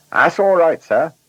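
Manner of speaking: high, loud driver's voice; reassuring driver. A narrator's reading. Driver answering his worried passenger, reassuring.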